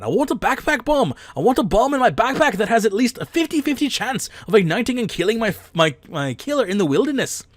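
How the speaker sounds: high, loud mock-Irish, drifting into Scottish; hammed-up Irish accent with big pitch swings; bad Irish accent. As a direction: read it out in a badly faked Irish brogue. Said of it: "I'm digging the accent regardless of it".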